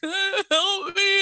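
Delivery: high, loud imitated tearful plea; hammed-up crying victim; mock crying. Trapped woman begging the medic for help, mock crying.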